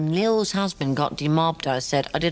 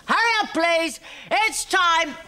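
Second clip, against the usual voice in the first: much higher, ringing closing-time call; barman's call; 11 semitones higher than usual. Acted. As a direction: call last orders across the pub.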